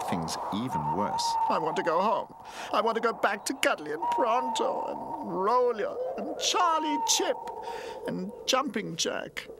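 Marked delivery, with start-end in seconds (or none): 1.5-9.5 put-on high-pitched childlike wail, slowing on the names; homesick little toy, wailing. A narrator's reading. Runaway toy wants to go home, high homesick wail. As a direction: wail for your friends in a tiny high voice.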